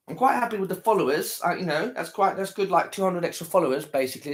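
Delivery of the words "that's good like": usual speaking voice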